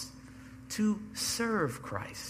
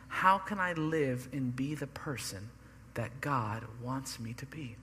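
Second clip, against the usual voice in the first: hushed whisper; 4 semitones lower, 7 dB quieter than usual. Shown as a demonstration. Pastor whispering the believer's question to the congregation. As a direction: breathe the question in a soft, unvoiced whisper.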